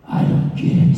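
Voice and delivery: rough, airy, dismissive grumble; gruff Vince. Retold or imitated. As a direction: brush the idea off bluntly. [name] voices a dismissive Vince.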